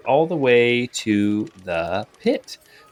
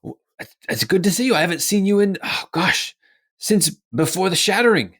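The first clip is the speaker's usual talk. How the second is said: higher, eager reunion chatter; fond old friend; 9 semitones higher, faster than usual. Acted. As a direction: greet a long-lost friend gladly. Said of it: "Gerard is saying things like".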